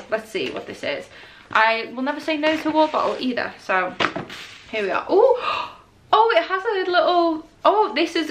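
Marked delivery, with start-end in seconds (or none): 5.1-8.3 high gasping coo; delighted gasp. Real, not acted. Fan gasping at the water bottle design.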